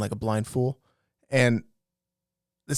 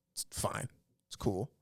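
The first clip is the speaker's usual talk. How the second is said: breathy whisper of "It's fine."; whispered aside; 4 semitones lower, 14 dB quieter than usual. Real, not acted. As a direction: repeat "It's fine." under the breath, voiceless. Repeats it, second time whispered.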